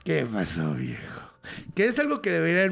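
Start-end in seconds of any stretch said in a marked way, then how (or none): 0.0-0.7 much lower, booming; a big deep voice from a tiny man; the small Muki calling back to the miner in a booming voice. Retold or imitated. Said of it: "hablaba así". 0.7-1.2 much lower, big bass voice; the small Muki answering the miner in a booming voice; booming deep voice. Retold or imitated. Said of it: "hablaba así".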